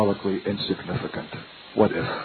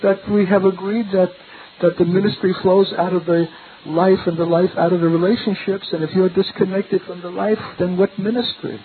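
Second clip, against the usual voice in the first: high-pitched cracked voice; 8 semitones higher than usual. Retold or imitated. Youngest member correcting his elder, high-pitched cracked voice. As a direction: imitate an awkward youth's high, strained pipe. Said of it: "in his high-pitched voice and cracked voice".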